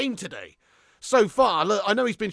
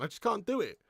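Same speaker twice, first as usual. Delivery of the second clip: ordinary talk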